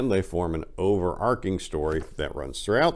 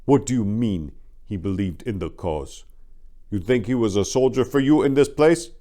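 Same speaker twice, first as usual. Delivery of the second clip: louder and higher, indignant challenge; indignant anger; 5 semitones higher, 6 dB louder than usual. A narrator's reading. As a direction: throw his words back at him in outrage. His anger on clear display.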